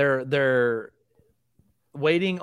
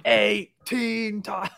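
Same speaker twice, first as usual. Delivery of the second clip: slow, raised-pitch Scottish comic voice; Robin Williams; 7 semitones higher, much slower than usual. Acted. Co-host echoing the golf bit, drawn-out Scottish imitation. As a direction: stretch it out in mock Scottish. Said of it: "Robin Williams describing golf ... in a Scottish accent".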